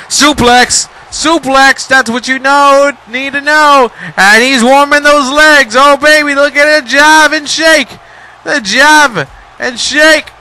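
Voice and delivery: high, loud hype call; excited hype. Real, not acted. Commentator hyping Brodus's moves, high and loud. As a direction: ride the big moment all the way up.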